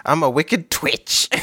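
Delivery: high cackling witch laugh; witch cackle. Pretend. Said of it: "That's my best witch laugh".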